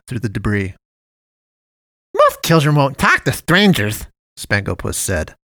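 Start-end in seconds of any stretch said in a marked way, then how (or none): 2.1-4.1 high, louder cartoon cat voice; sugary high cartoon voice; jolly cat man. A narrator's reading. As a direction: play the friendly dump cat, overly sweet. Cat man flatters boy.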